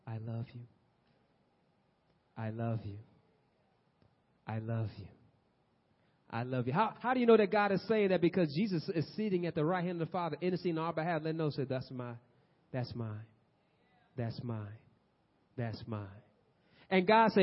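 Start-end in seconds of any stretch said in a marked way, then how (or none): 0.0-6.7 low, soft, tender repetition with long pauses; hushed loving reassurance from God; gentle. Acted. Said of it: "God is gently saying you and letting you know". 11.7-16.1 low, soft, tender repetition; hushed, assured intercession; gentle claiming. Acted. Jesus interceding, voiced softly.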